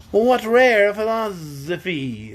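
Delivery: high, slow, admiring bug; Wogglebug voice. A narrator's reading. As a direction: savour it.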